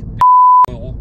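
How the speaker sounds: price bleeped out; high beep over the price; censor beep. Acted. Price hidden by sound.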